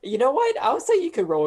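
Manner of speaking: laughing. Real, not acted. GM grants a roll, laughing.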